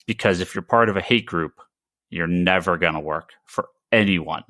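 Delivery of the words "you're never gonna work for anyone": ordinary talk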